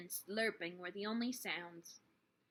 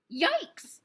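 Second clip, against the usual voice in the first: much higher and louder yelp of fright; startled yelp; 11 semitones higher, 11 dB louder than usual. A narrator's reading. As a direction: jump at the sight of the rat. Flora sees the rat.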